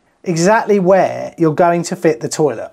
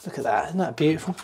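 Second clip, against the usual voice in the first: hushed admiration; 10 dB quieter, faster than usual. Real, not acted. Admiring the drilled hole.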